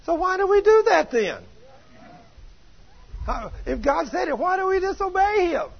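high-pitched, pressing rhetorical question; incredulous challenge. Real, not acted. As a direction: put it to them, voice leaping right up. Preacher challenges congregation with pointed question.

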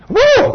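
high, loud preacher whoop; joyful woo. Real, not acted. Preacher whooping over the boy's faith, loud high woo. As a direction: whoop with delight.

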